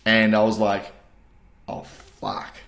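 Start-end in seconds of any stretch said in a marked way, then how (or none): 1.7-2.5 whispered retold dismay; hushed inner panic; dread under breath. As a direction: drop to a whisper, as if privately realising the disaster. Retelling his dread before the crowd.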